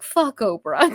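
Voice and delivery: playful quote for a sad partner. Retold or imitated. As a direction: fling it out cheekily. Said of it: "who will just be like".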